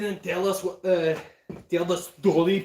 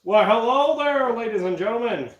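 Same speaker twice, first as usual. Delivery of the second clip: fifties news anchor. Acted. Greeting ladies and gentlemen, fifties news anchor voice. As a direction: announce it like a fifties newscaster. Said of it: "one of those nineteen fifties, um, news anchors".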